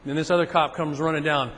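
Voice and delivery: ordinary delivery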